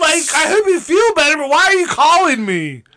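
annoyed, why are you calling; exasperated. Retold or imitated. Annoyed friend answering a call.

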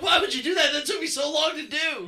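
high, loud exasperated shout; retold angry yell. Man scolds ex for destroying webs, high angry yell. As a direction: vent the wasted effort.

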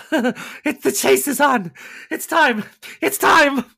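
high, loud, manic cry; gleeful predator. Acted. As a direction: crow that the hunt has begun. Predator announces the hunt.

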